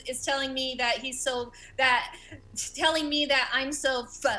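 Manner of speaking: usual speaking voice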